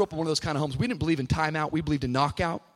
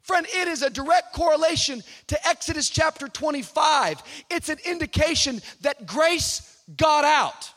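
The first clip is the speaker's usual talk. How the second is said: high, ringing proclamation; full-force preaching; 9 semitones higher, 4 dB louder than usual. Real, not acted. Preacher proclaiming that grace got out, fervent and high. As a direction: build to a fervent pulpit cry.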